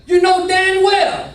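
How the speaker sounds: put-on cuss him out in a loud Southern accent; angry Southern shout. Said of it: "he'd get mad and cuss me out".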